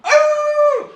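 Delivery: loud, very high werewolf howl; big theatrical wolf howl. Shown as a demonstration. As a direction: howl it overdramatically. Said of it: "you are the werewolf".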